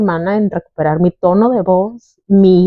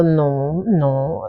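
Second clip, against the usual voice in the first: quoting herself; 4 semitones lower, 6 dB quieter than usual. Retold or imitated. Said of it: "y yo".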